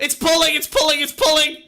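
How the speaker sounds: high, loud cries of pain; real panicked shouting while the bat prop pulls his hair; pained yelps. Real, not acted. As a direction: yelp for help as your hair gets yanked. Hair still caught.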